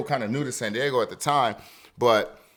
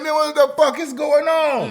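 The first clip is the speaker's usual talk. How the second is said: confused alarm; 13 semitones higher, 4 dB louder than usual. Retold or imitated. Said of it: "I'm like".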